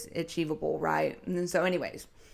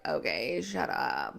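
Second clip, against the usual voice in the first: eye-rolling dismissal; 3 semitones lower, 6 dB quieter, slower than usual. Retold or imitated. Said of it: "roll your eyes and be like".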